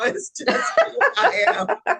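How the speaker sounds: laughing through the words. Real, not acted. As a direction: giggle the words out. Laughing at herself.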